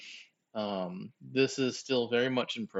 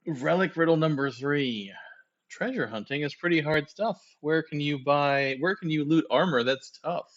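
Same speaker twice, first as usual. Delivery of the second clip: dramatic reading; 4 semitones higher, 4 dB louder than usual. Acted. Reads riddle aloud dramatically.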